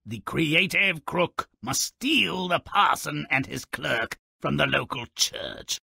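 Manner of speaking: usual speaking voice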